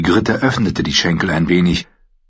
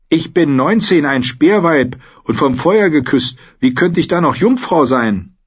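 hohe, kecke Frauenstimme, stolz; stolze Ygritte; 9 semitones higher, 3 dB louder than usual. Acted. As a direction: selbstbewusst und etwas lauter. (Ygritte, stolz und keck)

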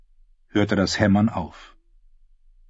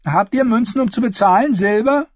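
put-on higher girl's voice, businesslike; a girl on the porch sizing up strangers, higher and guarded; wary ten-year-old Willow; 14 semitones higher, 5 dB louder than usual. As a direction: sound like a watchful child running the inn. Willow demands silver.

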